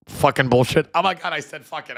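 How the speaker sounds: high, loud, giddy squeal; hammed-up thrilled boy shouting a naughty word; giddy mock swearing. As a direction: play a kid who can't believe he swore. Mock Vance giddy over swearing.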